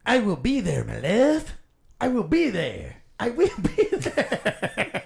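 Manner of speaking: high, loud, laughing lovestruck anime voice; high, loud mock-romantic anime line, laughing through it; hammed-up anime love line. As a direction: overplay the sappy anime hero, cracking up. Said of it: "For an anime coming up right here".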